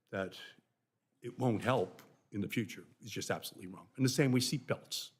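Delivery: ordinary talk, nothing marked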